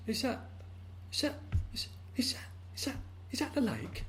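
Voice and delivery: high breathless whisper, stuttering; stunned stammer. Acted. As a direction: gasp it, unable to believe. Well frog awestruck at lake.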